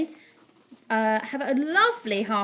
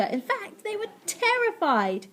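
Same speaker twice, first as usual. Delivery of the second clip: high, rising into the key word; heightened narration; 9 semitones higher than usual. A narrator's reading. Narrator tells of cats scared of water, high and dramatic. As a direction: stretch it dramatically.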